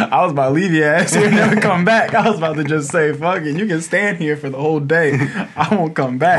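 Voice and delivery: high, loud talk breaking into laughter; laughing while telling. Real, not acted. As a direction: keep laughing as you tell it. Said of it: "laughing while telling".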